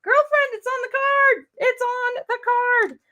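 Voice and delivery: high, slow mock-exasperated viewer voice; sassy onlooker teasing her mistake; imitating viewers. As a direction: viewers heckling that the answer was on the card all along. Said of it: "I bet you guys were like".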